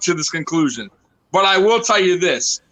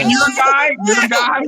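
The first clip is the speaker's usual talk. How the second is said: loud, higher outburst in the argument; real heated shout at a co-host; 8 semitones higher, 4 dB louder than usual. Real, not acted. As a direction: cut in hard to win the point.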